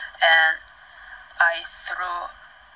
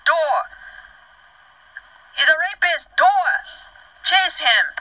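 high, loud cries for help; high-pitched shouted alarm; re-enacted scream; 11 semitones higher, 3 dB louder, slower than usual. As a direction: yell urgently to the guard, acting it out. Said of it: "I screamed".

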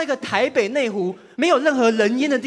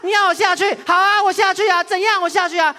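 much higher, louder huffy dare; angry girlfriend; 9 semitones higher, 4 dB louder than usual. Retold or imitated. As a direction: throw the challenge back, pitch way up. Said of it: "跟韩剧一模一样".

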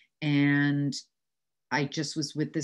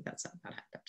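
whispered aside; 20 dB quieter, faster than usual. Retold or imitated. Deadpan retort to father.

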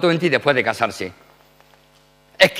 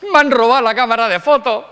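high, fast mock-tearful daughter; imitated tearful complaint, pitched up and rushed; nearly crying; 6 semitones higher, faster than usual. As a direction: blurt it out like an upset daughter. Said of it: "casi llorando".

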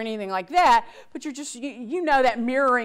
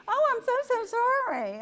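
much higher, gushing fake sorry; fake sympathy; 11 semitones higher than usual. Shown as a demonstration. Coworker faking sympathy to a complainer, gushing and high. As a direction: overdo it so the fakeness shows. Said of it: "they're gonna know you're faking it".

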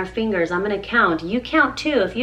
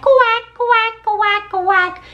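high, louder duck quack; mama duck quack; 9 semitones higher, 4 dB louder, much slower than usual. Acted. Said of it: "Mother Duck said".

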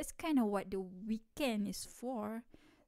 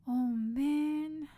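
louder dismayed exclamation; 3 semitones higher, 6 dB louder than usual. Acted. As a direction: blurt it out, hit by the news. Learning friend has insomnia.

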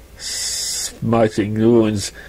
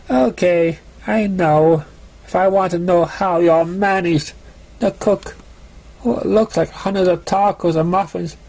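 higher, fed-up voice taking in the mess; exasperated Raindrops; 6 semitones higher than usual. Acted. Raindrops walking in on the wrecked kitchen, higher exasperated voice. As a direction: demand an explanation, baffled and annoyed.

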